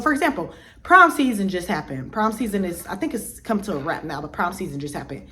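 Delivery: ordinary delivery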